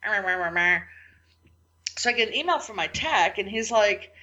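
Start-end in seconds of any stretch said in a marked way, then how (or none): 0.0-0.8 grumbling meh. Retold or imitated.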